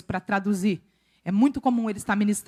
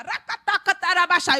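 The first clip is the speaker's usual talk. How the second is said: rattled mock-tongues gibberish, higher; 7 semitones higher than usual. Preacher imitates sudden praying in tongues, mock babble. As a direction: burst into babbled syllables, pitched up. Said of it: "tu começa a".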